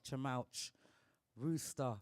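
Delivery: usual speaking voice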